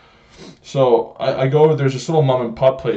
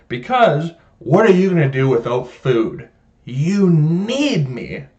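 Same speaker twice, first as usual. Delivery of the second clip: drawn-out, puffed-up manager voice; self-important attitude; 4 semitones higher, slower than usual. Acted. Grocery manager telling everyone they depend on him, self-important. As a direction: stretch it out with smug self-importance. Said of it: "that's their attitude".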